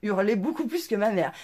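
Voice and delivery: usual speaking voice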